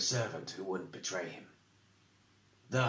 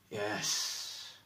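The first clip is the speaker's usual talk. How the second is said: sighed; 5 dB quieter than usual. A narrator's reading. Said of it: "he sighed".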